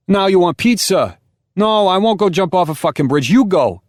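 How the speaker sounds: high, loud New York Italian yelling at his wife; New York Italian shouting. Acted. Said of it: "shouting too".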